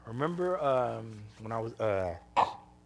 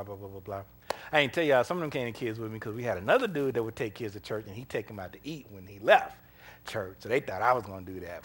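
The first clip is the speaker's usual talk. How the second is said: usual speaking voice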